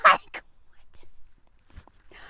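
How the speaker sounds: breathless disbelieving whisper; a hushed what; stunned whisper. Real, not acted. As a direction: whisper it, floored. Stunned whisper after a laugh.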